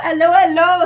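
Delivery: lightly put-on British accent, high and loud; playful British-accented greeting. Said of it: "with a British accent".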